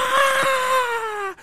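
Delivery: long, very high-pitched scream; sustained mock shriek; imitated scream. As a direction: wail it out in falsetto like the man being beaten. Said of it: "He's screaming like".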